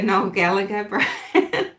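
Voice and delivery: laughing through the words, breaking into a breathy laugh; amused laughing. Real, not acted. Streamer amused at being compared to a singer, laughing. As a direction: let a real laugh take over the name.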